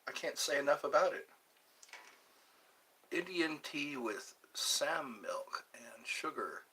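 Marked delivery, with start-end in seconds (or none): 3.1-6.7 slow soft whisper; a slow hushed whisper of the recipe. Real, not acted. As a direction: whisper it slowly, like a secret. Whispered aside about tea.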